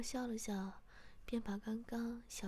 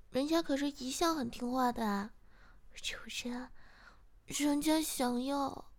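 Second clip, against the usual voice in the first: pouting; 3 semitones higher, 8 dB louder than usual. A narrator's reading. Answering the master with a pouted protest, pouting and coy. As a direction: plead with a pout. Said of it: "嘟着小嘴说".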